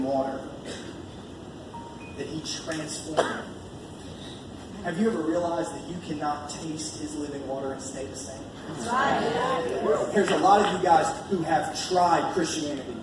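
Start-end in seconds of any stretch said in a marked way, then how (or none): none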